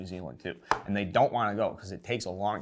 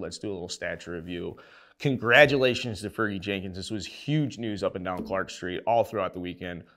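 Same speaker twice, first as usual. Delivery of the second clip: ordinary talk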